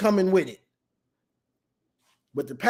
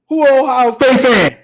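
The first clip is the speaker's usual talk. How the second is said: shouted high and loud across the room; loud call-out; 5 semitones higher, 11 dB louder than usual. Retold or imitated. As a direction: call out to the whole shop. Calling out in the dealership.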